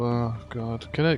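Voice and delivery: normal talking voice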